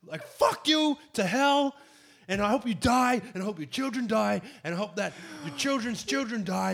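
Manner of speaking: high, loud hammed-up cursing; mock angry rant. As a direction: hurl curses as hostile Australia, over the top. Acts Australia cursing boat arrivals.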